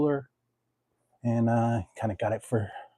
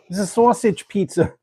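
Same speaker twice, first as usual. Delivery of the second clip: hammy mock Australian, higher pitched; 8 semitones higher, 3 dB louder than usual. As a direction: put on a jokey Aussie accent for the pizza. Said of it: "why am I talking like that? That's Australian".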